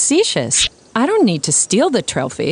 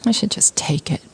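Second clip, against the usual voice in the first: whispered aside; 4 dB quieter than usual. Acted. Muttered aside, Mary plotting theft.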